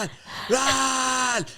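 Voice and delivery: high frantic yelled warning; shouting run. Acted. John overrun by elves, yelling high. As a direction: yell it up high in panic. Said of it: "he's just getting like".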